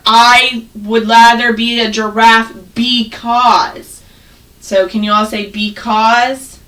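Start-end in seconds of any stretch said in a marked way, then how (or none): 0.0-4.0 slow example sentence. Shown as a demonstration. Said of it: "we use this in our writing".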